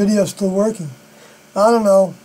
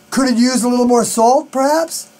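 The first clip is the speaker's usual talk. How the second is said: rising question tune, "perhaps" lifted high at the end; 3 semitones higher than usual. Retold or imitated. Partner tasting soup asks about salt as a tentative rising question. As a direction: ask it gently, ending "perhaps" on a clear upward lift. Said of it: "They would say it as a question".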